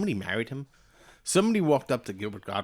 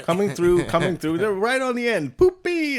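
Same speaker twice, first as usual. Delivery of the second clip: higher, louder mock call to clear the way; pushing through; 10 semitones higher, 5 dB louder than usual. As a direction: sing out to a row of seats, climbing in pitch. Acting out winner pushing through row.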